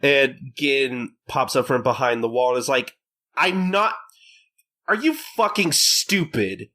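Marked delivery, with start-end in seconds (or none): 3.3-6.7 higher, slowed, incredulous scolding; annoyed Gintoki. Retold or imitated. Gintoki scolding Katsura from behind the wall, higher incredulous tone. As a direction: scold him like he's an idiot.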